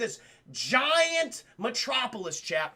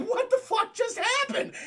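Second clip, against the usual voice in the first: high, rising disbelief; shocked; 13 semitones higher, 3 dB louder than usual. Real, not acted. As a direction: ask it stunned, pitch way up. Speaker repeats as own reaction.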